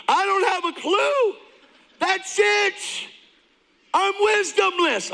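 high, full-voiced celebratory scream; elated screaming. New believer alone in an empty room, screaming with joy. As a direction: scream it out in joyful, drawn-out disbelief. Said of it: "I'm streaming at an empty room".

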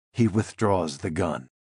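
ordinary delivery

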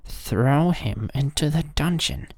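high, sharp royal command; angry king voice, pitched high. A narrator's reading. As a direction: order him thrown down in anger. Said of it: "was angry and cried".

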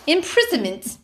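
over-pronounce "Imprisonment" like a Brit after wine; tipsy English accent. Pretend. Teasing friends after wine, mock English accent. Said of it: "Don't make me speak in my English accent".

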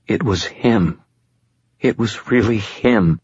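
frightened, slowing insistence; trembling. A narrator's reading. Shaken girl insists it was really him, trembling. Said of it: "her trembling growing more pronounced".

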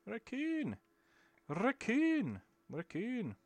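high sing-song calling; sing-song baby talk. Acted.

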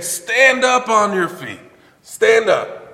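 loud, high, commanding quote. Retold or imitated. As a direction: call it out loud like a preacher quoting an order. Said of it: "Paul said in a loud voice".

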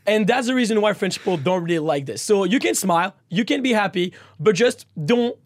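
ordinary talk, nothing marked

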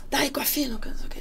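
high-pitched imitation of a telling-off; mock scolding. Chief scolding the drummer during tuning, mock high-pitched rebuke.

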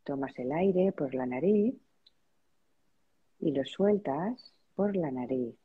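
spacious, measured relaxation-practice voice; guided meditation. Real, not acted. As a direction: lead them gently into noticing their breath, unhurried. Leads a breathing meditation.

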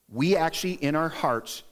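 normal talking voice

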